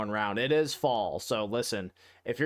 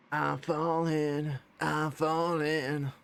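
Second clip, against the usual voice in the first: slow falling wail; 4 semitones higher, much slower than usual. Pretend. Fish falling, drawn-out voice.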